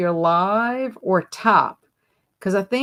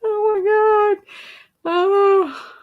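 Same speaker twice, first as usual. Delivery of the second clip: high, drawn-out oh my God; shocked squeal; 13 semitones higher, 4 dB louder, much slower than usual. Real, not acted. Host reacting to a chat answer, squealing high in shock. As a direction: caught off guard by a funny comment.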